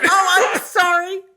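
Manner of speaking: high, slow, sing-song sorry; drawn-out fake apology; mock apology. Co-host answering the tease with a high, slow mock apology.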